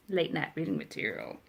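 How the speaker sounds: ordinary delivery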